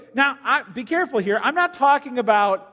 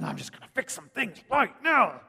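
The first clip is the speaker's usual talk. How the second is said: mild mock menace; a hint of a dad about to snap; understated angry father; Hulk dad; 3 semitones higher than usual. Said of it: "you've been Hulk dad".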